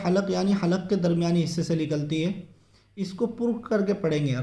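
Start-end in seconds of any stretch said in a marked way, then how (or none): none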